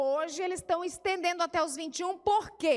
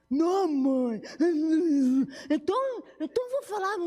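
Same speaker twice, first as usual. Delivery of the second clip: put-on whiny teen; 3 semitones higher, 4 dB louder than usual. Child whines at mother in story.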